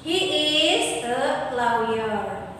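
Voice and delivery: slow, careful model sentence; a teacher reading a model answer slowly. Shown as a demonstration. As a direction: spell it out slowly for the class. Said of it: "she-nya nanti diganti jadi he".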